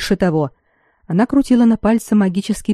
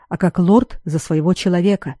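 ordinary delivery, nothing marked

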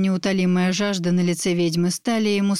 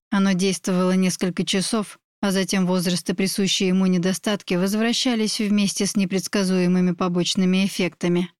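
plain reading voice

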